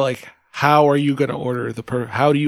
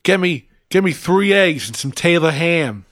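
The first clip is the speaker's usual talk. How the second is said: put-on New Jersey accent; 3 semitones higher than usual. Customer ordering at a Jersey diner, New Jersey accent. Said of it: "you're like".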